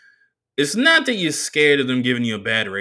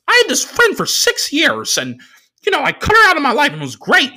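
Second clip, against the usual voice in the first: much higher, louder nerdy driver voice; the driver's voice; 8 dB louder than usual. Retold or imitated. Comedian doing the driver's voice for the crowd, high and squeaky.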